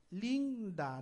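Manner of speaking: Linda divided clearly as lin-da; split into syllables. Shown as a demonstration. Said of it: "la última sílaba de linda".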